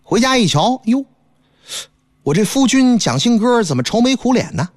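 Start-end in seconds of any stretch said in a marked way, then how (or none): none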